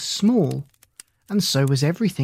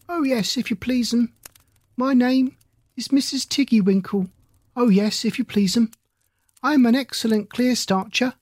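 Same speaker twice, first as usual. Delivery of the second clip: higher, humble hedgehog washerwoman voice; Mrs Tiggywinkle; 8 semitones higher than usual. A narrator's reading. Hedgehog washerwoman answering Lucy politely, higher humble voice. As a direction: bob a curtsy with the words, high and polite.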